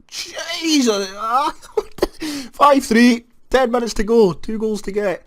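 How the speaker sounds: high, loud yell of disbelief at the goal; yelling with a burst of laughter, then counting the minutes; shocked joyful shout at a late goal; excited shout. Real, not acted.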